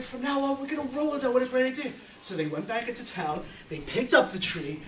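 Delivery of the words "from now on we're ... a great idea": higher, eagerly parroting the decree; delighted fools. Acted. Foolish workers repeating the wise man's plan, higher and eager. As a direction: sound proud of someone else's idea. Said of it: "So they said".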